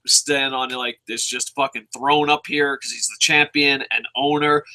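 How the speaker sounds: normal talking voice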